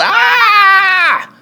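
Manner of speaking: very high loud scream; parents' yell. A narrator's reading. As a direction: shriek as your ears get grabbed. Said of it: "they both yelled".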